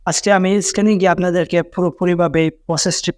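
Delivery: normal talking voice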